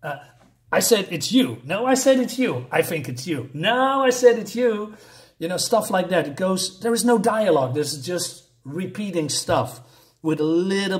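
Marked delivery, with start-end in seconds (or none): 0.7-5.0 higher-pitched, hammed-up contradictions; mock bickering. Reviewer parodies dialogue that goes nowhere, mock bickering. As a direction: bat the accusation back and forth, higher on each no.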